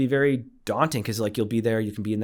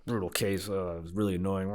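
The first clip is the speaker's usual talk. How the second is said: grumpy and sad; 4 dB quieter than usual. Retold or imitated. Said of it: "kinda sad".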